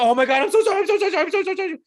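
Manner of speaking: high-pitched loud panicked apologizing; hammed-up squealing remorse; frantic apology. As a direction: gush apologies to a hurt dog. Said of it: "when you accidentally step on your dog".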